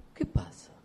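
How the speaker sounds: breathy whisper, almost to himself; a whispered rhetorical "¿Qué pasa?"; whispered question. Acted. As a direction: drop to a hushed whisper. Preacher drops to a whisper.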